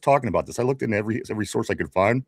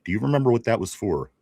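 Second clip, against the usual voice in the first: ordinary talk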